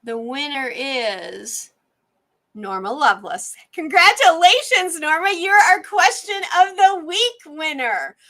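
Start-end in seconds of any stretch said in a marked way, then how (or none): 3.7-8.2 excited, high and loud congratulations; hyped giveaway announcement. Real, not acted. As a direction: cheer the winner's name out big. Announces giveaway winner on stream.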